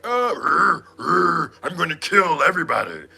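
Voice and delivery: mock Jaguar rage. Imitating Jaguar raging in the car. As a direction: growl it like a wild woman. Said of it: "Jaguar was like".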